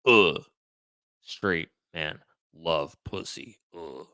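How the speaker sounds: low, slow, grunting macho caricature; straight-man voice. Shown as a demonstration. Answering a viewer's request, low straight-man caricature. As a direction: grunt it like a mock straight guy. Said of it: "Come on to the straight side voice".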